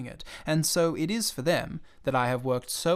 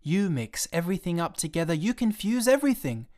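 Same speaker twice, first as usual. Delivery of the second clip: put-on rising, higher furious child; very angry; 5 semitones higher than usual. Little prince scolding the pilot, rising anger. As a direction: build the rage to the end. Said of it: "He was really very angry".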